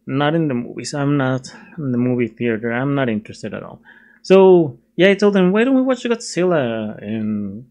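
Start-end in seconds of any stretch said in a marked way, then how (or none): 5.5-7.0 put-on higher-pitched Valley Boy accent. Vlogger quoting himself suggesting a movie, Valley Boy accent. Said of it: "and that's my Valley, Valley Boy accent".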